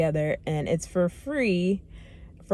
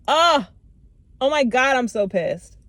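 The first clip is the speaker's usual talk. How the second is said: frustrated outburst; 6 semitones higher, 4 dB louder than usual. Real, not acted. Outburst at having to redo video.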